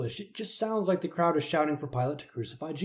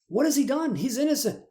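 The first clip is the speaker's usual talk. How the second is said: pleading Pilate; 11 semitones higher, 4 dB louder than usual. Acted.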